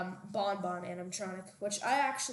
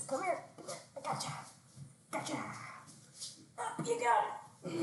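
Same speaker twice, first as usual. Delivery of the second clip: calling a dog; 8 semitones higher, 3 dB quieter, faster than usual. Real, not acted. Lifting dog.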